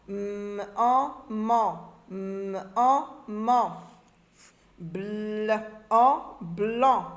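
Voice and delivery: held consonant sound, separate "en", then blended syllable. Shown as a demonstration. Teacher drilling forming and reading syllables, sounds separated then blended. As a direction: sound out pan, rang, bran, blanc piece by piece. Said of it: "Forme et lit des syllabes".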